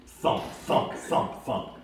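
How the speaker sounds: loud, breathy thumps that fade step by step; imitated heavy footsteps. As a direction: stomp it out like giant feet. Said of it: "sounded like a Clydesdale on two legs".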